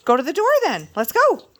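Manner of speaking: rally the dogs to go out; excited calling. Real, not acted. Calling dog to door.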